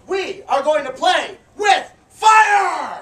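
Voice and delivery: slow, loud showman announcement; hype shout. Real, not acted. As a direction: whip the crowd up. Hyping the crowd.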